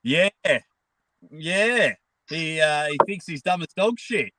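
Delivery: usual speaking voice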